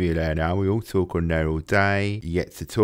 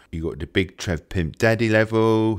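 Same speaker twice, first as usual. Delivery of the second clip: ordinary talk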